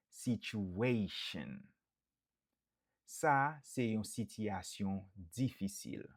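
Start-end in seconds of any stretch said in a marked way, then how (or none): none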